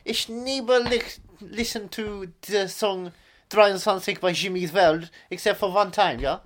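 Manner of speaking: halting German-English caricature; comic German accent, mixing both languages; mock German accent. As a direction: chat as a cartoon German. Keeps up comic German character.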